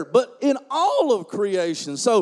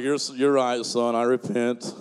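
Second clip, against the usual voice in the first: mock repentance; 11 semitones lower than usual. Preacher answering his son, in mock contrite tones. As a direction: play up a sheepish apology, laughing. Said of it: "I'm like".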